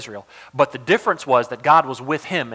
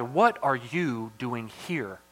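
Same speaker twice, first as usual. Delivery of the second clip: slow question leaning on "you" and "here"; stress on YOU and HERE; 3 semitones lower, 7 dB quieter, much slower than usual. Shown as a demonstration. God questioning Elijah in the cave, stress on "you" and "here". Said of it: "a big emphasis on you".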